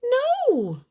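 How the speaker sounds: high, loud cry; frightened cry. Acted. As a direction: yelp it out in defiance. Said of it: "cried the little pig".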